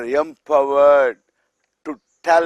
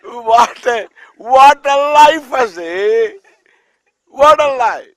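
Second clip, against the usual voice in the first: mock exclamation; 7 semitones higher, 8 dB louder than usual. Mocking the feared father's life.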